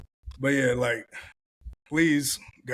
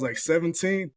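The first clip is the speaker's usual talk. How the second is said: ordinary talk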